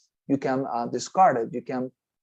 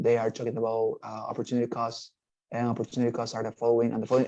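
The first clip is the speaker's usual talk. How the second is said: softer monotone through "opportunity costs are the following"; dull, quiet, unvarying tone on "opportunity costs"; low, stable tone; 3 semitones lower, 5 dB quieter, faster than usual. Shown as a demonstration. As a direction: drone "the following and the following" on one level note. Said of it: "a super, you know, low and, and stable, uh, tone of voice".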